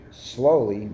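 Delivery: ordinary delivery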